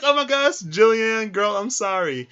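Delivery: high, loud mock apology; flustered apology. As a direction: blurt out an over-the-top sorry. Acted apology after being screamed at.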